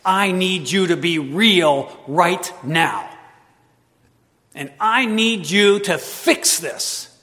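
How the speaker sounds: loud, slow, demanding prayer; heated plea. Retold or imitated. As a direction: demand God act, word by word. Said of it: "having a heated discussion with him".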